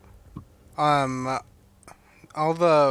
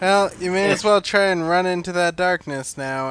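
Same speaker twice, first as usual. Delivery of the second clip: louder and higher, playful goading; in-character urging; 4 semitones higher, 6 dB louder than usual. Acted. Player's character telling Luca to run into darkness, loud urging. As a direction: urge a companion onward in character. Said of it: "I'm gonna be like".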